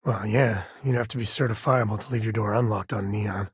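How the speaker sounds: ordinary talk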